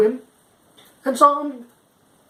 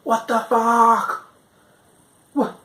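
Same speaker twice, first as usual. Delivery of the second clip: slow, high, drawn-out disbelief; stunned, slow what the fuck; shocked reply; 6 semitones higher, much slower than usual. Retold or imitated. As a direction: stretch it out in outrage. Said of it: "he's replied with".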